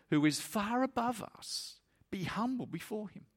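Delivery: high, pleading sermon climax; impassioned preaching. Real, not acted. Preacher pleading with his congregation about God's love, impassioned. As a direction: plead with them at full height.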